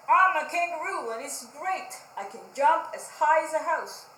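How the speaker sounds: loud, proud bragging; boastful Pig. Acted. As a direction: brag about your jumps to the parrot.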